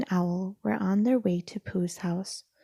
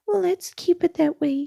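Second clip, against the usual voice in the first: high, loud anxious cry; cried out; 10 semitones higher, 7 dB louder than usual. Acted. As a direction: shout it while running off. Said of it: "he cried".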